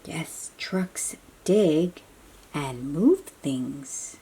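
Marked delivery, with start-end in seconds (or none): none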